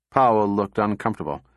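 usual speaking voice

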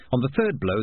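usual speaking voice

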